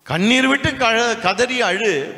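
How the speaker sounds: high, slow mock urging of a preacher. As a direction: parody the preacher urging tears. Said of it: "பதில் வந்துரும்ன்றாங்க". (ஏளனமாக உயர்ந்த குரலில் போதகர் பாணி)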